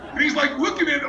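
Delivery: ordinary talk, nothing marked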